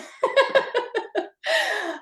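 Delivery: sudden high laugh breaking out; embarrassed high laugh at her phone; burst of laughter. Real, not acted. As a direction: laugh it off, caught by surprise.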